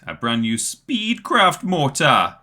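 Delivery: slow, put-on Jamaican accent, high. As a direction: drawl Speedcraft mortar in a Jamaican accent. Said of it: "say mortar in a Jamaican accent".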